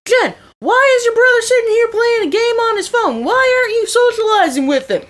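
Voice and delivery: high, indignant mock tirade; retold screaming. Matt scolding Jen for her gaming brother, mock outraged yelling. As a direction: holler a hammed-up scolding, pitched right up. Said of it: "he screams out".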